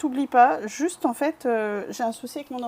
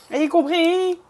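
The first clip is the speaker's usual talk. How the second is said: loud, high cry of getting it; real eureka shout; triumphant realisation; 7 semitones higher, 10 dB louder, much slower than usual. Real, not acted. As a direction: exclaim as the penny finally drops. Sudden realisation after long confusion.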